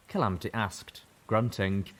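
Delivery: ordinary delivery, nothing marked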